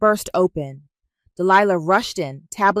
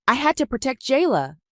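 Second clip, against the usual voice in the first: higher-pitched, heated protest; voice rising; 7 semitones higher than usual. A narrator's reading. Aunt defending her choices to her niece, voice rising. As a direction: push the voice up in defence. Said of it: "her voice rising".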